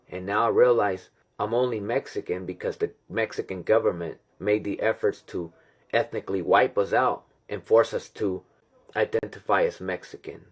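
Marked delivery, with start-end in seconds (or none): none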